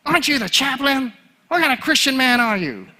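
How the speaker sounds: high-pitched, indignant mock scolding; hammed-up high voice of reproach; scolding woman. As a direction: imitate a woman telling off the chaplain. Acting out Susie's scolding question.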